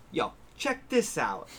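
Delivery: put-on a loose New York old-man voice; New York accent. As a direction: imitate an old New Yorker showing off. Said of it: "a thick New York accent".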